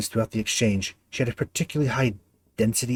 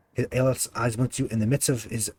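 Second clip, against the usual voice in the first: ordinary talk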